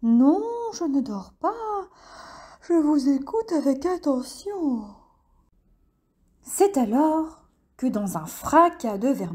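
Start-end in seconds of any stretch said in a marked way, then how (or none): 0.0-4.8 high, sleepy protest; nodding off, insisting he's awake; drowsy lizard voice. A narrator's reading. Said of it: "Le pauvre hochait la tête".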